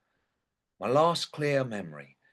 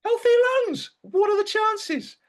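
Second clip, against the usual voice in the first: much higher and louder, disbelieving; incredulous; 7 dB louder than usual. Acted. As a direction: shriek the disbelief at clean lungs. Dead man astonished at autopsy.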